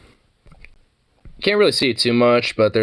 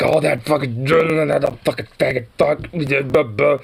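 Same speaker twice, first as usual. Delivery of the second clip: higher, garbled mock ranting; imitating mad coworker; 6 semitones higher than usual. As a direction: mimic a heated grumble that dissolves into blahs. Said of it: "he was just getting all mad".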